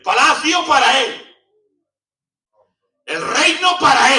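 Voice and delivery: king proclaiming at full voice, high and loud; impassioned shout. Acted. King decreeing his kingdom to the killer, impassioned shout. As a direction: pour everything into the decree, shouting.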